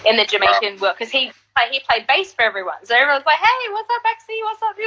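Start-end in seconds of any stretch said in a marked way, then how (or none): 3.4-4.8 high, friendly mock calls; playful chorus of greetings; imitating greetings. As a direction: call out hello to everyone. Everyone greeting her voiced.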